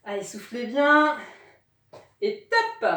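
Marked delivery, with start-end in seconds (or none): 2.2-2.9 called out. Real, not acted. Coach calls end of exercise.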